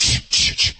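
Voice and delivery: whispered "shh, shh, shh"; shushing. Retold or imitated. Imitating Cesar Millan calming dogs, whispered shush. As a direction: hush the dogs with a breathy shush. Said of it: "as he said".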